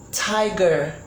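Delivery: ordinary talk, nothing marked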